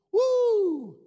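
high, loud "woo"; long, high cheer of delight; excited whoop. Real, not acted. Preacher cheering an amen verse, high excited whoop.

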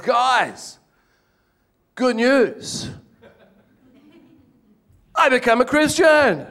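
high, eager announcement, loud at the end; excited news. Retold or imitated. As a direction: proudly tell the whole crew. Telling firemen his news.

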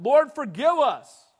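loud, slow, pleading cry; a loud, drawn-out plea for forgiveness. Retold or imitated. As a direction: call out to God for mercy. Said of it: "congressmen crying out".

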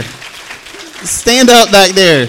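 long slow shout to the back; slow drawn-out call. Real, not acted. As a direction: stretch the call out slowly. Calling to couple in back.

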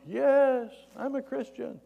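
high-pitched call back from above; mock holler down, easing off after the first word; acted distant answer; yelling down. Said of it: "The guy yells down".

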